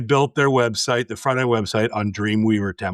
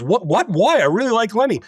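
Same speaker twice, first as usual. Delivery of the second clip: high, louder imitation of a baffled boss; pleading, pitched-up objection; retold surprised protest; 9 semitones higher, 4 dB louder than usual. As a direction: protest in mock bewilderment.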